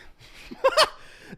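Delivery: high giggling laugh; burst of laughter. Real, not acted. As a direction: crack up laughing. Laughing at own joke.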